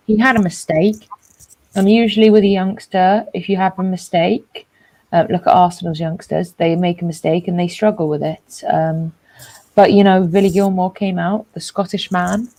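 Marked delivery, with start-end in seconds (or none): none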